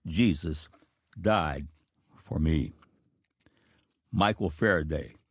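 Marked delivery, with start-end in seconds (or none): 0.1-2.7 "Jesus... died... for me" slow and low, with long pauses; a dying man's slow last words, lower and drawn out; slow, spaced words. Retold or imitated. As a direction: lay each word down slowly, lower. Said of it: "he slowly said".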